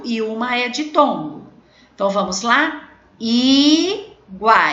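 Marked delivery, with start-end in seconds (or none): 3.2-4.7 "Iguais" split with a long, loud first I; loud, drawn-out syllable division of "Iguais". Shown as a demonstration. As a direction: stretch "Iguais" apart, syllable by syllable. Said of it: "Eu separei".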